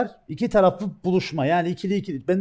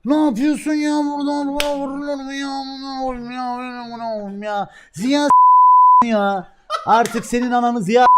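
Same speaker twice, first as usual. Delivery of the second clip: mimicking a friend's indignant cursing, much higher and louder; cursing rant; 7 semitones higher, 7 dB louder than usual. Retelling Tugay's rant at the streamer as a high, indignant cursing voice. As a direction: throw outraged curses back, higher. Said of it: "Bayağı bir küfür etti orada".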